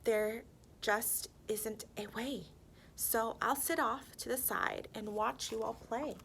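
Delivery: soft, resigned sadness; soft dejected. A narrator's reading. Rick resigned to sitting out, soft sad voice. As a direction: quietly give up. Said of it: "Rick sighed".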